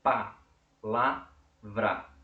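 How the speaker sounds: "Pa, la, vra" in three separate bursts; syllable by syllable. Shown as a demonstration. Teacher repeating the three syllables, "Pa, la, vra" split apart. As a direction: punch out "Pa, la, vra" one syllable at a time. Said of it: "Três sílabas".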